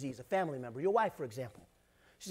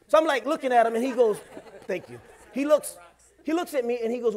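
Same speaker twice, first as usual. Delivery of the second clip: full-force preaching; 6 semitones higher, 8 dB louder than usual. Acted.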